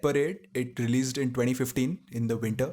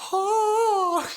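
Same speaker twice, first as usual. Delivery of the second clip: high excited fangirl gasp; fangirl squeal; 6 dB louder than usual. Retold or imitated. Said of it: "That's a very accurate fangirl voice".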